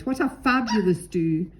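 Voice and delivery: plain reading voice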